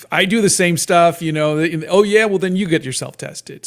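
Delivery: mildly heated back-and-forth; retold argument. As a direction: act out a family dare, pushing back. Retold family quarrel over diagnosis.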